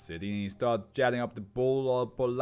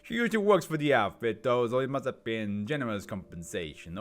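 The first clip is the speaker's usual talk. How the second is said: ordinary talk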